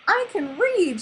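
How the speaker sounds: high realization; high, happy realization; delighted cry. A narrator's reading. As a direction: cry it out as it dawns on her. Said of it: "she cried".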